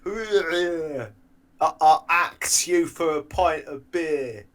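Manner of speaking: slow, high caricature of a mate; mocking impression of friend. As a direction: mimic your friend, dragging out the words. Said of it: "do me when I say to you that I fancy a pint".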